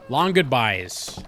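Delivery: slow and loud, mock weary; sarcastic drawl. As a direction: drag it out, teasing the long goodbye. Mocking the long dialogue.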